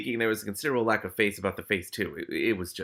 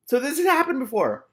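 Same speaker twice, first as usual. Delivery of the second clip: surprised aside; 8 dB louder than usual. Real, not acted. Reader's aside after the outburst.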